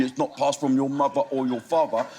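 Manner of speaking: normal talking voice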